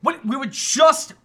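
mock-indignant confusion; getting mad. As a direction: act out snapping at your girlfriend over a dream. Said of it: "getting mad at her".

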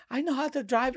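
high, soft protesting driver; defensive driver. Retold or imitated. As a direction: whine that he can drive.

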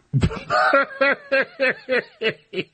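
a long, high peal of laughter. Real, not acted. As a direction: let a long laugh roll out. Laughing at wasted hour.

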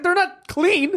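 high, loud mock outrage; incredulous protest. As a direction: protest as if it should be obvious. Retold objection to pool as shower.

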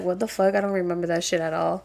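retold reaction dropped to a whisper; hushed disbelief. Telling a friend her own sleepwalking reaction, hushed whisper. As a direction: whisper it like you can't believe it.

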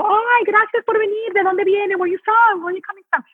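bright, high welcoming voice switching into Spanish; gushing welcome to fans at the stage door; warm Spanish greeting. Retold or imitated. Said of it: "go into my Spanish and go".

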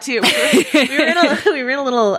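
real laugh breaking into the words. Real, not acted. Guest starting a meeting anecdote, laughing through it.

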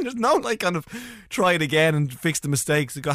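ordinary delivery

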